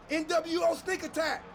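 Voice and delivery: high, excited attack call; battle cry. Acted. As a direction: announce the ambush like a war cry. Announces a sneak attack.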